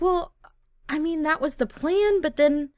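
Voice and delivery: defensive. Pretend. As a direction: play a girlfriend awkwardly justifying herself. Girlfriend defensively explains herself.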